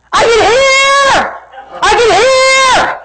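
high, drawn-out yell; acting out an overjoyed girl's scream; imitated joyful yelling. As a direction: cry it out like someone newly healed. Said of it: "And she starts yelling".

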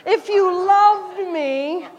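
very slow, high, flirting plea; flirty Delilah. Retold or imitated. Delilah batting her eyes at Samson, slow high flirt. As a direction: drag it out, batting your eyes. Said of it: "batting her eyes at him".

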